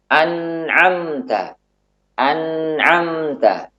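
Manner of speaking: very slow "an'amta", the n humming briefly; slight flow on nun. Shown as a demonstration. Teacher demonstrating the nun for students, very slowly. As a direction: hum the n of "an'amta" a moment, not long. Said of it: "Nun juga demikian".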